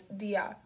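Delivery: "dear" split into dee-ah, no R; two-syllable "dear". A narrator's reading. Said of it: "Two syllables".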